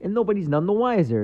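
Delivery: high, punchy mock-gangster aside; Joe Pesci. Comedian quoting Pesci clarifying to the audience, lightly impersonated. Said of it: "He's trying to clarify something. He's like".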